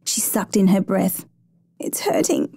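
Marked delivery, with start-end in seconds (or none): 1.8-2.5 high, thin whimper of pain; narrator's acted whimper. A narrator's reading. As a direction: whimper it like a hurt little girl. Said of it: "she whimpered".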